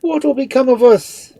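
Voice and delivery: high, very loud wail; frantic friar's outburst; panicked exclamation. A narrator's reading. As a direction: cry out in helpless dread. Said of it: "ejaculated the friar".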